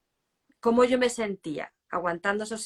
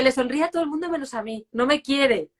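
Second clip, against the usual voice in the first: higher, louder re-enacted lament; acting out hurt, voice raised; retold anguished complaint; 6 semitones higher, 6 dB louder than usual. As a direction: protest it like a rejected mother. Mother acts out her past anguish.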